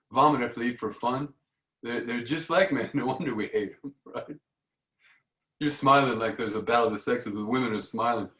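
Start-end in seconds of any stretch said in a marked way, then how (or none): none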